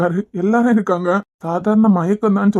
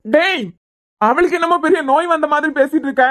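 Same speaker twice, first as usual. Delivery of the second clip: higher, heated protest; angry rebuke; 6 semitones higher than usual. Acted. Father angrily rebuking the doctor friend, raised pitch. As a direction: push back at him, upset.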